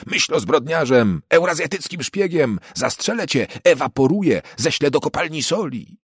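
put-on a zealous boy hurling accusations; child's shouting. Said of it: "wrzasnął mały". (mały chłopiec krzyczy na Winstona, wysoko i głośno)

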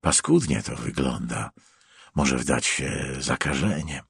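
low, softened murmur; muttered. A narrator's reading. Stranger commenting on an injured man's wound, muttered. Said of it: "mruknął przybysz".

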